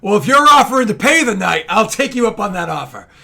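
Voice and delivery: loud, high, jovial outburst; boisterous acceptance. Acted. Companion takes up offer to pay, boisterous and loud. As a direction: jump on it at full volume.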